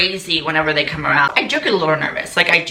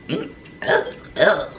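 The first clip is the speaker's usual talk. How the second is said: awkward groan; 3 dB quieter, slower than usual. Retold or imitated. Awkward reaction to his DM.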